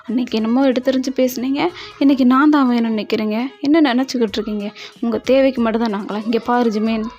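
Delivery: loud, hurt and angry; heated angry retort. Acted. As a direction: hurl the accusations back loudly. Heated quarrel, shouting back. (கோபமாக உரக்க எதிர்த்துப் பேசுதல்)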